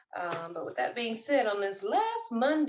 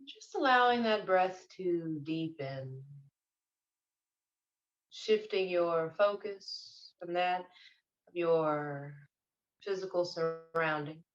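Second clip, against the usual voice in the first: guided meditation. Real, not acted. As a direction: ease them gently into their breath, unhurried.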